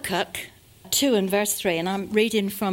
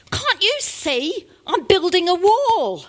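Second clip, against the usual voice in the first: higher, louder, slow exasperated retort; second bricklayer fed up with the question; irritated; 8 semitones higher, 5 dB louder than usual. Acted. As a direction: snap back in annoyance.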